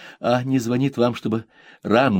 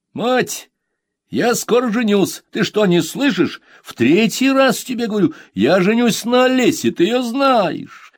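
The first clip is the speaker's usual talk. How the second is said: son's voice pitched high and loud, pushy; grown son; 13 semitones higher, 6 dB louder than usual. Acted. Son nagging his busy mother, high and insistent.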